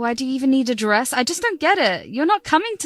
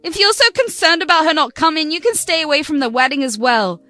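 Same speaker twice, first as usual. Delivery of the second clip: higher, louder, "what" punched as she throws it back; furious retort; 4 semitones higher, 4 dB louder than usual. Acted. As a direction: fire the question right back at him. Daughter throwing question back.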